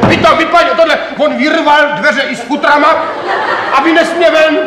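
loud, high, agitated account; shouting in rising outrage; worked-up storytelling. Acted. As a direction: get carried away with indignation while retelling. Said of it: "Já se vždycky tak rozčílím, když tohleto vyprávím".

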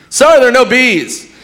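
high, loud host announcement; mock host. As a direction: read the bad news cheerily. Host answers.

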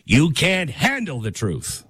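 a lightly voiced Jack Nicholson, slow and intense; slow, intense movie line with a hint of Jack Nicholson. Quoting the movie line to viewers, lightly voiced Jack Nicholson. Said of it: "I'm turning into Jack Nicholson".

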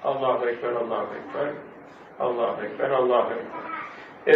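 low, soft imitation of the ezan; hushed, low-pitched hint of a call to prayer; muezzin's call, understated. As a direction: lightly echo a muezzin, pitched low and quiet. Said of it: "Müezzin ezan okuyor".

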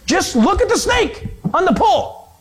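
high, strained insistence; urgent urging. Acted. Pleading with a dying man to look, urgent urging.

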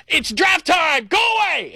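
high, slow shout; yelling. Retold or imitated. Draft junkie shouting his wife away at draft time. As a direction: yell it at her like draft is sacred. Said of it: "And I go".

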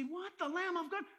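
high, rising incredulous question; baffled questioning. Acted. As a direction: echo it back as if it makes no sense.

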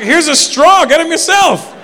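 high, loud comeback; exclaimed, hammed-up retort; mock exasperated retort. Acted retort to the woman.

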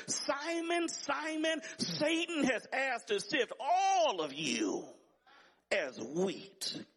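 high, ringing pulpit cry, dropping on the last words; fervent high-pitched scripture quote; urgent preached warning. Retold or imitated. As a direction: call the name high and urgently, then drop away. Quoting Jesus, preached at full pitch.

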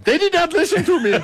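high, hammed-up mock Indian accent. Radio host mimicking a man pleading with bank staff, mock Indian accent. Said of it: "made fun of their accent".

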